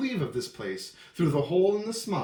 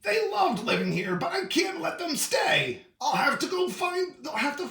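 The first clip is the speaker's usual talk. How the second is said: Lorax voice raised; 12 semitones higher than usual. Acted. Lorax continues.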